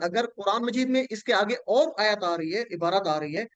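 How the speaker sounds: usual speaking voice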